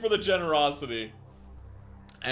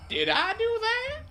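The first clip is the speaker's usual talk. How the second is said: high squeak; 5 dB louder than usual. Real, not acted. Squeaky surprised aside.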